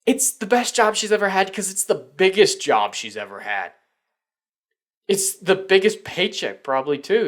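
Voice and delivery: high, loud bitter rant; scornful. Real, not acted. Vlogger ranting about the actress, high, loud and scornful.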